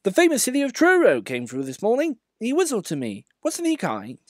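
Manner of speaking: kindly Edward. Acted. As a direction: chat gladly like a gentle old engine.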